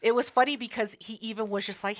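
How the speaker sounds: usual speaking voice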